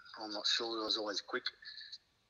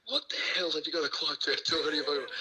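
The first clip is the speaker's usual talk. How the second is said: a mild mock yell across the room, higher; retold yell; 5 semitones higher, 3 dB louder than usual. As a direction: call it out half-hammed. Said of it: "Hindmarch just yells across the room".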